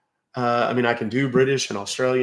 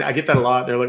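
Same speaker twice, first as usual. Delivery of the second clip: normal talking voice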